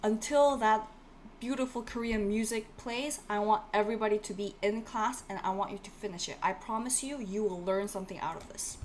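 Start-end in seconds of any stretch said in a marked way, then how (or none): none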